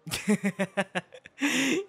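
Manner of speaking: loud, high laugh; burst of laughter. Acted. Teasing the scriptwriter with a loud laugh. Laughs at her own joke.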